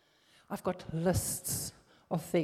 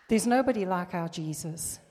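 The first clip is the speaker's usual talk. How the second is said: usual speaking voice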